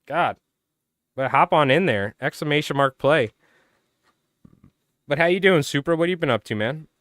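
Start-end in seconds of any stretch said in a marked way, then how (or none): none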